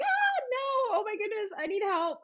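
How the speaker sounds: mock panic. Retelling the pit bull screaming back, mock panic. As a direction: shriek it out like a frightened foster owner. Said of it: "I was like".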